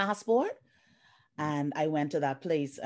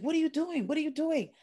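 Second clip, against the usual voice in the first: high, startled what-are-you-doing; incredulous man; 11 semitones higher than usual. Retold or imitated. Client stunned as she pushes the ID across, high incredulous. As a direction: pitch up, baffled and demanding. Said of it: "he looked, he was like".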